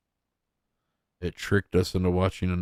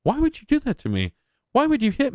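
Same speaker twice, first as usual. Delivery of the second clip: mocking whine; faster than usual. Mocks streamer's whine.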